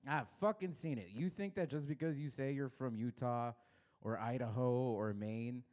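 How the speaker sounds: ordinary talk, nothing marked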